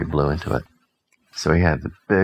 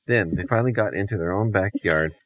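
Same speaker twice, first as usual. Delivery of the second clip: usual speaking voice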